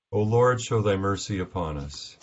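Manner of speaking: ordinary talk, nothing marked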